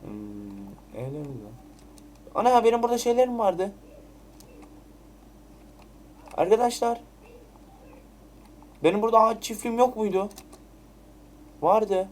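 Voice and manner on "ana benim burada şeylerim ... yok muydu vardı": shocked disbelief. Real, not acted. Finds his farm missing.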